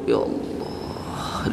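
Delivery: drawn-out, hushed mock-pious sigh; feigned humility. Preacher mimicking a man faking devotion, feigned humility. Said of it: "dari gaya jalannya ketika dia ngomong itu".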